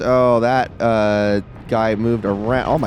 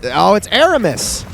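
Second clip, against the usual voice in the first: high, raised exclamation of recognition; surprised realization; 7 semitones higher, 4 dB louder than usual. Real, not acted. Player spotting Aramis, surprised. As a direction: pitch jumps up as the enemy is spotted. Player suddenly spots the boss.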